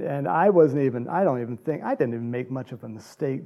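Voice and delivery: usual speaking voice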